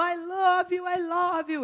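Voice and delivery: put-on playful high Bahian-accented English. Teasing an older American friend, Bahian accent. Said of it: "Eu falo assim com sotaque bem baiano".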